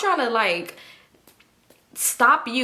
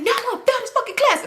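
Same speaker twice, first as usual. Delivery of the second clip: high, fast mock-angry wail; mad; 14 semitones higher, faster than usual. Test time up after the fight, high mad wail. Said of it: "I'm mad as fuck".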